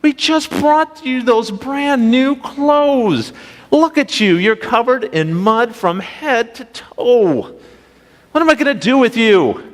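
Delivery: high-pitched, slow, exasperated grandma scolding; storming grandma. Retold or imitated. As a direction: scold the muddy kids like a shocked grandma. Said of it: "she came storming out that door and go".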